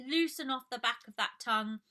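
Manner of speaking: ordinary talk, nothing marked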